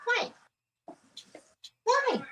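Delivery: high, sing-song voice for the dog; pet talk. Real, not acted. Owner cooing high to her crying dog, sing-song pet talk.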